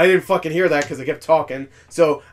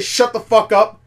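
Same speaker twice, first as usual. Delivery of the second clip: raised, higher annoyed retort; irritated retold outburst; 6 semitones higher, 7 dB louder, slower than usual. Retells snapping at chatty friends.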